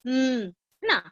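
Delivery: "म" held as a humming m sound. Shown as a demonstration. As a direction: hum the m. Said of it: "And म को".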